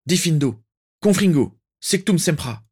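high, fierce volley of incantations; barked spells. A narrator's reading. Orion strikes back at his attacker, high barked spells. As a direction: bark each curse at the attacker. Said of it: "se tourna vers le lanceur et aboya".

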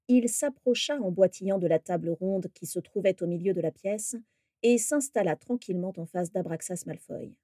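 ordinary delivery, nothing marked

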